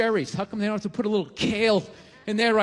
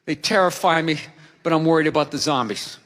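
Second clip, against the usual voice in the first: lower, sheepish honesty; 8 semitones lower than usual. Retold or imitated. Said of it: "I go".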